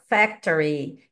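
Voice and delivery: "Factory" said slowly and deliberately; a slow, careful "Factory"; teacher repeating "Factory" slowly for students; slow model again. Shown as a demonstration. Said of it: "Agora eu soltei aqui".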